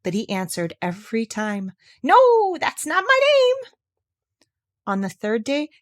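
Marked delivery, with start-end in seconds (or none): 2.0-3.7 very high, slow taunting no; squeaky little man. Acted. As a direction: gloat over her wrong guess. Little man refuses again.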